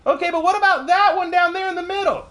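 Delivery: very high cartoon trickster; Bugs Bunny. Rabbit asking about the rock in the middle, very high cartoon voice. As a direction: press the villain in a squeaky-high cartoon voice. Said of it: "Bugs walks down".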